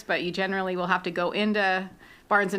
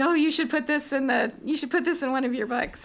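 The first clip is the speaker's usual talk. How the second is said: higher-pitched teasing quote; mimicking friends' suggestions; 7 semitones higher than usual. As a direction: lightly imitate pals pitching ideas. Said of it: "they're like".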